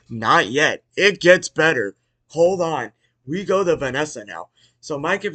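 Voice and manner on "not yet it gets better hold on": loud, drawn-out "not yet"; emphatic hype. Real, not acted. Host telling viewers it gets better, loud hype. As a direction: punch it out loudly to build anticipation. Hyping what comes later.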